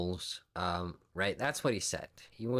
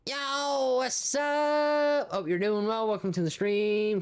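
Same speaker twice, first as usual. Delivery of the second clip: high, loud hype welcome; excited greeting; 12 semitones higher, 5 dB louder, slower than usual. Real, not acted. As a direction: shout a hyped hello to a viewer. Greeting a new viewer loudly.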